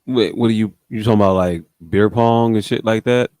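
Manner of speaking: usual speaking voice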